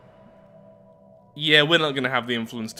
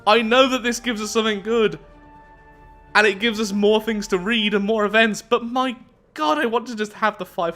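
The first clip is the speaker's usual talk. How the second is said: high-pitched, louder grumbling; exasperated complaint; 9 semitones higher, 4 dB louder than usual. Real, not acted. Torn over costly choice.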